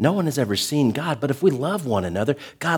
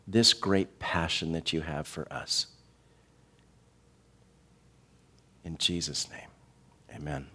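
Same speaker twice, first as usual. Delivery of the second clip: soft, earnest prayer, fading lower; quiet closing prayer; 4 semitones lower, 7 dB quieter than usual. Acted. Pastor praying over the congregation, soft and earnest.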